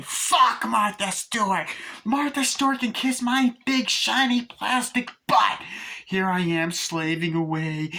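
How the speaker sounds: unload every grievance in a shrill tirade, pitched high; Tiffany ranting. Retold or imitated. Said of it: "She goes".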